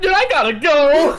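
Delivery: high, loud mock panic; desperate to leave. Driver escaping the customer, loud comic desperation. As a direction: blurt it out, exaggerated and urgent.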